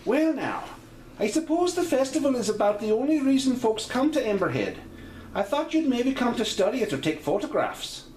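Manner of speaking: chatty Celtic-lilt hostess; Irish-Scottish landlady. Acted. GM voicing landlady May.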